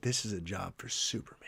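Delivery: very soft and low, almost under the breath; quiet, sincere. Retold or imitated. Dad repeats what his daughter said, hushed and sincere. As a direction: imitate a child's hushed realisation. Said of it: "turns to me quietly and says".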